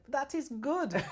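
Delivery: put-on loud, high Jamaican-accented mum. Daughter quotes her mum on becoming president, Jamaican accent. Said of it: "She's in her Jamaican accent".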